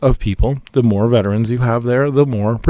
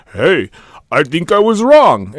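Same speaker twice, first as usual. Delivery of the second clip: higher, hammed-up fake Russian accent; bad Putin accent; 7 semitones higher, 5 dB louder than usual. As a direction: ham up a terrible Russian accent. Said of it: "And I did a terrible accent".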